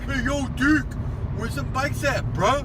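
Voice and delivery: high, loud joking holler. Pretend. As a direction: shout up at him like a friend on the street. Hollering at the statue.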